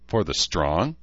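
plain reading voice

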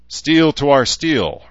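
loud, high, slow ritual response; intoned. A narrator's reading. Herzer answering the blood oath, intoned loudly and slowly. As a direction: intone it with drawn-out weight. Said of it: "Herzer intoned".

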